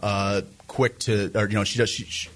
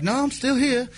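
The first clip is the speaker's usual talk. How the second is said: high fake British call; bad British accent; 12 semitones higher than usual. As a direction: imitate the phoney Brit piping up. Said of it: "talking in the worst British accent".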